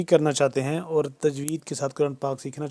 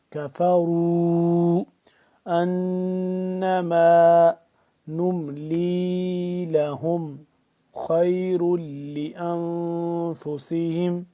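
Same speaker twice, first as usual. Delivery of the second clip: slow, louder melodic tajweed chant; formal Quranic recitation; 4 dB louder, much slower than usual. Shown as a demonstration. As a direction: recite with full tajweed, drawn out. Recites verse slowly.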